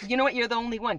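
ordinary talk

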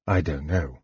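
low, subdued murmur; softly. A narrator's reading. Tanis thinking of a friend's dying words, soft and low. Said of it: "Tanis said softly".

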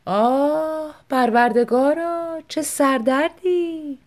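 slow complaining wail; moaning. A narrator's reading. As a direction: groan it out theatrically. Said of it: "ناله کنان گفت".

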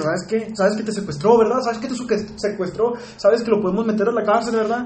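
high, pressing mother, each question rising; insistent mom. Retold or imitated. Mother pressing her son, high and insistent. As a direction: push him with rising questions.

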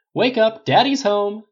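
loud, high holler into a room; big sing-out wake-up call. A narrator's reading. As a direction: announce your arrival across a workshop. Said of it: "Tony called into the silent workshop".

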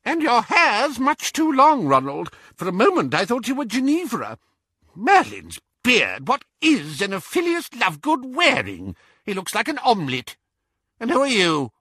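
high, bad-tempered old woman; barking elderly witch. A narrator's reading. Great-aunt finding fault with Ronald, high and barking. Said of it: "she barked at Harry".

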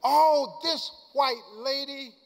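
normal talking voice

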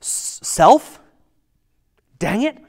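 frustrated pep talk to oneself; exasperated self-talk. Retold or imitated. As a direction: snap at yourself in the mirror. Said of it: "he's saying to himself".

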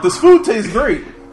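slow, loud, high smug voice. Retold or imitated. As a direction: gloat slowly and loudly. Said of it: "And I'm like".

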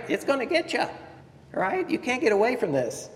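high-pitched, hammed-up alarm; mock-scared, voice jumping way up; mock panic. Playing up the disciples' panic.

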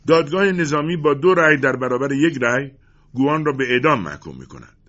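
loud, high, formal proclamation; firm voice. A narrator's reading. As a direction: declare the verdict firmly to the court. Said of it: "با صدای محکمی گفت". (با صدای محکم و رسا)

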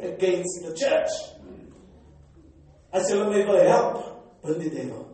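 loud, high, driving sermon delivery; full-force preaching. Acted. Pastor urging his church to bind the devil, loud preaching. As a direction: hammer the point home to the congregation.

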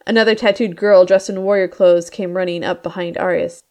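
ordinary delivery, nothing marked

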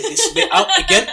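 high, loud, laughing through the words. Real, not acted. Host joking with guest about cutting this bit, laughing. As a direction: crack up while talking.

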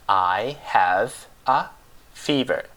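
"I have a fever" drawn out, pause between each word; slow word-by-word model. Shown as a demonstration. Teacher repeats for learners how to report a temperature, slow separated "I have a fever".

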